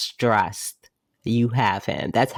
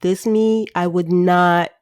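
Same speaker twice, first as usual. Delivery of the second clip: raised, overly sincere reassurance; high mock earnest voice; 5 semitones higher than usual. New Joe assuring his friends, high mock sincerity. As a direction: squeak it out like a brave kid. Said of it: "New Joe's like".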